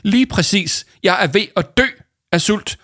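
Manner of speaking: high, loud overly grave voice; hammed-up solemn complaint about hunger; very serious. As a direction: play starving melodrama with a straight face. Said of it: "sagde Paul meget alvorligt".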